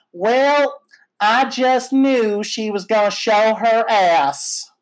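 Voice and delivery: slow, sweet Alabama Southern drawl, imitated. Elderly Alabama woman in the customs line, slow Southern drawl. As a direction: draw out every word like an old Alabama lady. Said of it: "in just the sweetest, like, Alabama Southern drawl just go".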